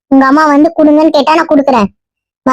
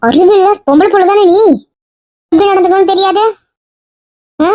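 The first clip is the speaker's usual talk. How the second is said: higher-pitched, indignant telling-off; cross mother's rebuke, pitched up; angry scolding; 6 semitones higher than usual. Acted. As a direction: scold a daughter for her behaviour. Mother angrily scolding her daughter.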